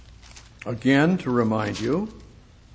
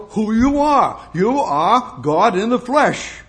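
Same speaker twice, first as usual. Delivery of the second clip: louder, slow proclamation; preacher's climactic declaration; proclaiming; 7 semitones higher, 5 dB louder, slower than usual. Real, not acted. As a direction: declare it slowly with full weight.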